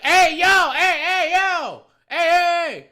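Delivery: high, loud hollering for attention; yelling. Retold or imitated. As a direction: imitate a woman yelling across at someone. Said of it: "Why are you yelling at me".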